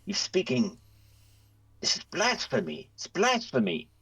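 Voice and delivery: high, appalled priest voice; horrified. Acted. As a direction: recoil in horror at blasphemy.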